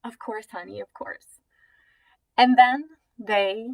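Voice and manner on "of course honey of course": reassuring mom. Retold or imitated. Mom answering her pestering girls, imitated quiet reassurance.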